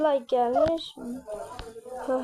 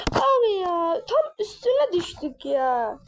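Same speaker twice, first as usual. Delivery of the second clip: dismayed cry; 6 semitones higher, slower than usual. Real, not acted. Gamer groaning to viewers after his character falls on it, dismayed. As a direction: sound crushed that you fell right on it. Falls right onto it in game.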